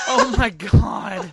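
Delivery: loud, slow shocked cry. Real, not acted. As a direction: gasp it out loud. Reacting to the story.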